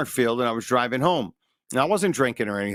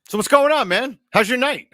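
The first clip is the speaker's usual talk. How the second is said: much higher and louder, all buddy-buddy; suddenly friendly cop; 12 semitones higher, 6 dB louder than usual. Retold or imitated. Cop greeting the driver after seeing his brother's shield, suddenly bright and chummy. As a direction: switch to a cheery old pal. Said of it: "he just changed on a dime".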